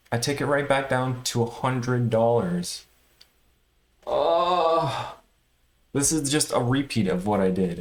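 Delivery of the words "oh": high, crying-out oh of dismay; pained cry of despair; anguished oh. Real, not acted. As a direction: wail it at losing everything. Watching gains vanish again.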